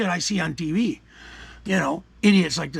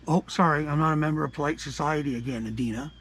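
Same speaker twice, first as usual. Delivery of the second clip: sarcastic mock apology; 4 semitones lower, 5 dB quieter than usual.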